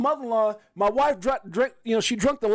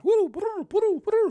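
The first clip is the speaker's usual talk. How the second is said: much higher, hooting bird calls; mock bird chirping; 13 semitones higher, slower than usual. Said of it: "in the bird coop chirping".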